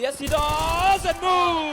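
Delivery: high, loud, drawn-out hype yell; excited shout. Real, not acted. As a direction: scream the big play. Caster erupts at the clutch.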